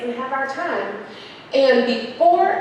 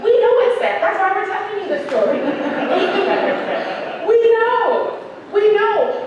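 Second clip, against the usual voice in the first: exasperated; 9 semitones higher, 4 dB louder, slower than usual. Retold or imitated. Exasperated retort to so sad.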